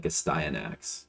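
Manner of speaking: Astyanax as "uh-STY-uh-nax"; probable pronunciation. Shown as a demonstration. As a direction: say Astyanax with "sty" as in eye, falling at the end. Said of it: "it's probably something like".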